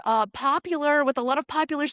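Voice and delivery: ordinary delivery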